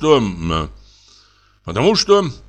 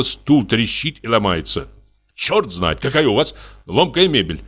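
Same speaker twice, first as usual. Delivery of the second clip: ordinary delivery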